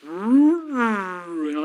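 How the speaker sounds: long droning noise swooping up, then sinking; slowed-down voice imitation. Telling how voices sound in a time shift, slowed-down drone. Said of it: "other people's voices sound like".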